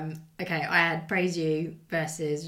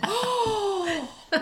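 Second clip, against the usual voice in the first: mock gasp; 13 semitones higher than usual. Shocked at Welsh remark.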